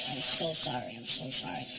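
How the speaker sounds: low pleading sorry; groveling apology. Retold or imitated. Comic imagining apologizing to the prince, low. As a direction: beg forgiveness down low.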